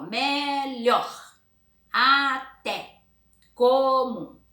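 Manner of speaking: "Melhor", "até", "comum" loud and high, split by syllable; stressed syllable stronger. Shown as a demonstration. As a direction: stretch each word so "lhor", "té", "mum" stand out. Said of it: "vocês pronunciam".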